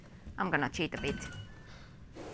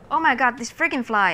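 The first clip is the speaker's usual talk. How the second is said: annoyed outburst; 5 semitones higher, 7 dB louder, faster than usual. Real, not acted. Annoyed at a fly.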